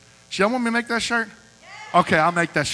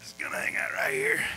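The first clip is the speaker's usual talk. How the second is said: put-on breathy kid voice; 5 dB quieter than usual.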